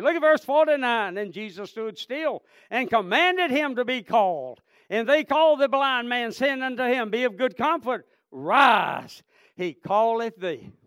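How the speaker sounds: loud, forceful Bible reading; fervent pulpit recitation; scripture preached aloud. Real, not acted. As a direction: proclaim the verse to the congregation.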